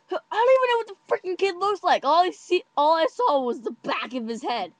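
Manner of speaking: high, loud exasperated rant, settling slightly; exasperated outburst. Retold or imitated. Friend who only saw back of his head, exasperated. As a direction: rant it loudly in frustration.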